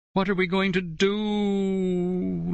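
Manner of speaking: high slow sobbing wail; tearful drawn-out cry. A narrator's reading. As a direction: wail it through tears. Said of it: "Lauren sobbed".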